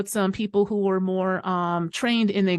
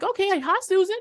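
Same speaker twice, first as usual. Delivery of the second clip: surprised greeting; 10 semitones higher, 4 dB louder than usual. Retold or imitated. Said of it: "I'm like".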